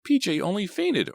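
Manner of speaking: high, loud brushing it off; dismissive boy. A narrator's reading. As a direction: wave it away confidently. Artie insists, brushing it off.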